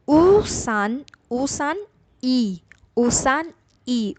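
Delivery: slow, with ऊसान and ई said apart as two separate words; slow, separated. Shown as a demonstration. Teacher slowly separating the parts for students, ऊसान ई kept apart. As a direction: spell out ऊसान ई slowly, syllable by syllable. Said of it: "speed में बोलेंगे थोड़ी तेज़ी से".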